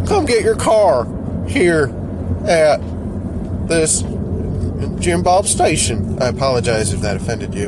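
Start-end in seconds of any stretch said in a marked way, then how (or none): 0.1-6.2 cheesy car salesman character. Acted. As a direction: drag it out like a cheap local car ad. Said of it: "I wanna keep doing that".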